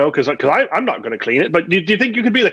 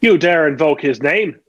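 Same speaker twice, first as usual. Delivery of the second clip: Irish pirate voice; 6 semitones lower, slower than usual. Acted. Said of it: "most nefarious Irish pirate".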